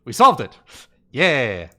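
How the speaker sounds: loud burst of "we solved it", shouted in triumph; triumphant cheer. Real, not acted. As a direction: yell it like you just cracked the puzzle. Mock celebration as mirror turns.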